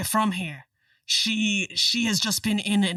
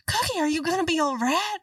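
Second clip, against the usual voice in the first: lightly voiced worried woman; 10 semitones higher than usual. Charlene asks in a high voice.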